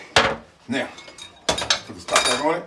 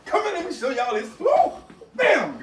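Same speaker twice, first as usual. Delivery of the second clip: excited whoop. Real, not acted. Cook thrilled by his roux.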